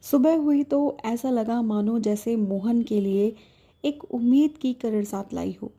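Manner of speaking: plain reading voice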